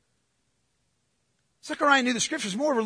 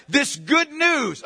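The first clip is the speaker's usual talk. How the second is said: slow, weighted "This good news"; emphatic; 3 semitones higher, slower than usual. Acted. Angel delivering good news to Zechariah, slow and emphatic. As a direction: give each word of "This good news" its own weight. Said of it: "again emphatic".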